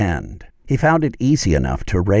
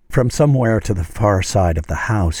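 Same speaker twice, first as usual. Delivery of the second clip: ordinary delivery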